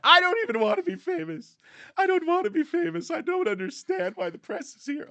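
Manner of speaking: very high, loud mock celebrity complaint; exaggerated high-pitched protest, mocking a star; put-on falsetto, loudly feigning distress at fame; Meghan Markle. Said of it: "than Meghan Markle".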